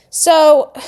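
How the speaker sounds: ordinary talk, nothing marked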